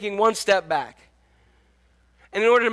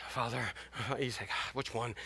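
nervous muttering; 9 semitones lower, 18 dB quieter than usual. Retold or imitated. Nervous son practising.

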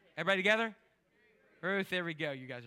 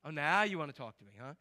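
sarcastic; 5 semitones lower, 3 dB quieter, faster than usual. Acted. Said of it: "He kinda gives that talk".

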